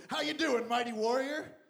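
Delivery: loud, high, hearty angel greeting; hammed-up jovial angel shout; booming angel. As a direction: greet him like a booming herald. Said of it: "and goes".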